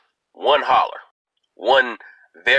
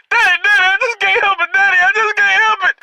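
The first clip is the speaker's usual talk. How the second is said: high sobbing wail; wailing daughter; 5 dB louder than usual. Retold or imitated. Grieving aunt wailing to her father, high sobbing cry. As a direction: wail it through tears, out of control.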